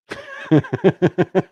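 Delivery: amused chuckle at the comment; real laugh. Real, not acted. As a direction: laugh off the teasing. Laughs off Southern drawl remark.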